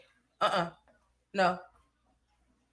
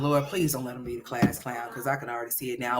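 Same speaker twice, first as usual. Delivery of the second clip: usual speaking voice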